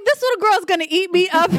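high, loud, rapid outburst breaking into laughter; comic alarm. Retold or imitated. Mother realizing her little girl will outshine her, comically alarmed. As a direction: exclaim it in laughing mock panic.

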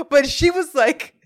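usual speaking voice

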